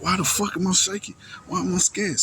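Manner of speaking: high, frantic retold panic; panicked self-question. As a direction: ask yourself in rising alarm why you're shaking. Retelling his own panic while shaking.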